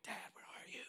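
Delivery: put-on hushed whisper of searching kids. Kids searching a dark house for dad, whispering. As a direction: whisper it with no voice, softly. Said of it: "And they're whispering".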